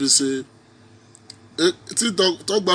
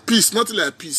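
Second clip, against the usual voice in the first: ordinary talk, nothing marked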